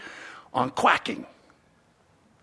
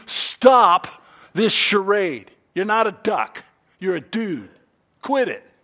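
louder, firm order; stern command; 7 dB louder than usual. Retold or imitated. Armed friends ordering the fake duck to stop, loudly. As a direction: shut down the charade. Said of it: "we say simply to you".

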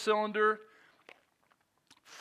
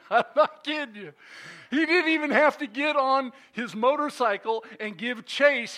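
laughing; 8 semitones higher, 7 dB louder than usual. Real, not acted. Laughing telling of the cop.

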